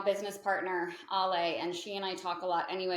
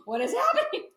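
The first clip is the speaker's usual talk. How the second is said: much higher, laughing mock alarm; laughing disbelief; 7 dB louder than usual. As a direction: squeal it out through laughter. Retelling scary end-times feeling, laughing.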